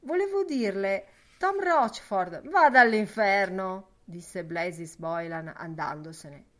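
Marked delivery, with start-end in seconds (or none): 0.0-2.5 loud, raised, urgent appeal; pleading. A narrator's reading. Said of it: "supplicò Lenihan". 2.5-4.0 very loud, irritated brush-off; angry dismissal. A narrator's reading. Boylan cuts him off, leaving.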